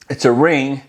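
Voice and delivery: usual speaking voice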